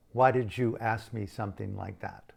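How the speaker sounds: ordinary talk, nothing marked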